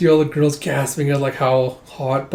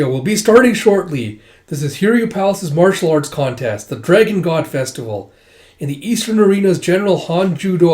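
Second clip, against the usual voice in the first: announcer; 3 semitones higher, 4 dB louder than usual. Acted. Tournament announcer opening the contest.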